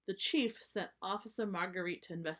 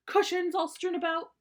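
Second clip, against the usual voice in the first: high, loud indignant mama bear ranting; scandalized mom, voice climbing higher and louder; outraged mother; 11 semitones higher, 9 dB louder than usual. Acted.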